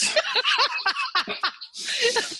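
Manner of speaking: a real high rising laugh. Real, not acted. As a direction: break into a bright high laugh. Laughing at host's "Oh, Jesus.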